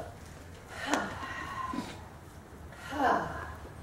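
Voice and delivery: long breathy sigh of release; demonstrated sigh. Shown as a demonstration. Teacher showing the class an annoyed sigh. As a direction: let it all out in a sigh. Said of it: "and you go".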